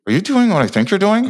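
high, tight-lipped ventriloquist mumble; through closed lips. Retold or imitated. Girlfriend whispering through closed lips on camera, high and tight. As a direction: squeak it out without moving the lips. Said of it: "her lips are kind of closed, like a ventriloquist".

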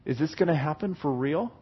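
soft, low, doubtful question; quiet private doubt; beginning to wonder. Acted. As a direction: ask it under your breath. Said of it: "you begin to wonder".

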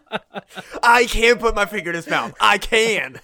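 high, loud, laughing insistence; giggling pretend protest to angry mourners; laughing mock protest. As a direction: keep insisting through the laughter. Laughing acted defence.

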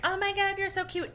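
high-pitched mock gushing. [name] cooing over the cat, high gushing imitation. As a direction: coo it way up high, imitating a smitten girl.

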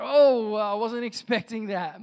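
high, loud "oh" easing downward; mock surprise, starting loud and high; taken aback. Believers react to God's unexpected plan.

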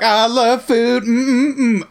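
lightly voiced dreamy Serena, slow; moaning with food delight. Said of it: "she's just like".

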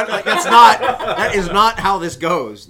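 loud, high objection through real laughter; exasperated and laughing, pitch up; laughing protest. Real, not acted. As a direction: protest while cracking up. Laughing protest at botched lyrics.

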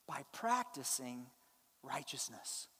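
low and soft, quoting the verse gently; hushed, low reading. Shown as a demonstration. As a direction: drop the voice low and softly read "By practicing righteousness". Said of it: "look how he says to do it".

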